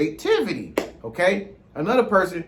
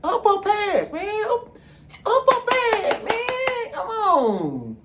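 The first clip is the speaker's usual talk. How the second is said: high calling stoner voice; 12 semitones higher, slower than usual. Acted. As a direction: call out to pass the joint, high. Calling to pass the joint.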